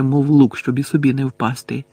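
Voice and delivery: ordinary delivery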